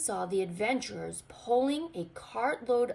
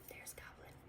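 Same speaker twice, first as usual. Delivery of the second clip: whisper; 24 dB quieter than usual. Acted. Aside to listening child.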